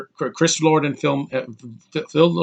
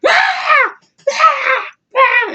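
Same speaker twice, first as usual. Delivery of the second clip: very high, loud repeated screech; three piercing mock-angry shrieks; imitated angry shriek; 6 dB louder, much slower than usual. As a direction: shriek like a malfunctioning voice assistant. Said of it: "She made this weird sound, like she gets angry".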